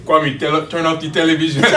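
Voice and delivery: put-on stepfather quoted in a heavy Nigerian accent. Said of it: "with the heavy Nigerian accent".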